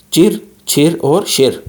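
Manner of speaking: चिर, छिर और शिर slow, r curled back; retroflex chir chhir shir. Shown as a demonstration. Teacher drilling the three retroflex syllables. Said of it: "रेट्रोफ्लेक्स होते हैं".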